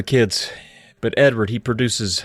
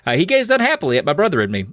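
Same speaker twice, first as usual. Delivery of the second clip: usual speaking voice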